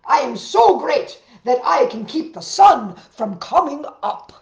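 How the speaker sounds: loud bragging bear voice; boasting bear. A narrator's reading. Bear boasting to the chipmunk he can stop the sun. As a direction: brag it big and loud like a bear.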